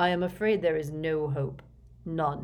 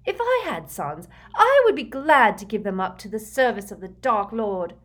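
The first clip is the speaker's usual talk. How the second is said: loud, raised-pitch fanatical declaration; ruthless; 5 semitones higher, 9 dB louder than usual. A narrator's reading. Bellatrix shaming her weeping sister, ruthless and loud. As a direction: proclaim devotion forcefully, no sympathy. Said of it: "said Bellatrix ruthlessly".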